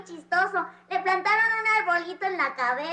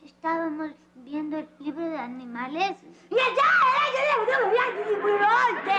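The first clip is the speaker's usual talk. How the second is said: hammed-up crying, building; tearful retelling. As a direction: start controlled, then break into wailing. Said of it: "¿Por qué estás llorando?".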